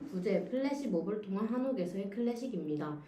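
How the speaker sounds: usual speaking voice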